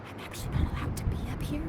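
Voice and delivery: fast, hushed whisper; quick conspiratorial whisper; secret whisper. Real, not acted. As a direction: whisper it so the owners won't hear. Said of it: "we have to be super discreet".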